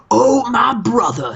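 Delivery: a hint of a preachy wrestler calling his partner; Devon impression. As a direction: lay on the brotherly swagger. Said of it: "Devon just goes".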